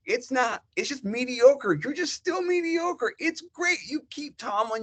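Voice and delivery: sarcastic high-pitched jeer; mocking. Real, not acted. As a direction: taunt the fans in a lilting high voice. Mocking Pittsburgh's mediocrity.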